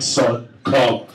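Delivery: low solemn command; voice of God. Retold or imitated. God laying a hand on his head, low solemn. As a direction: intone it like God.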